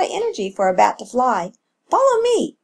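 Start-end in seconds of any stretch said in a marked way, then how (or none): 1.9-2.6 high, lilting call; mother calling the pup along. A narrator's reading. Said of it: "Echo's mother called out".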